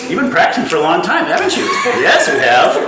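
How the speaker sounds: laughing mock praise; laughing tease. Real, not acted. Teasing, laughing.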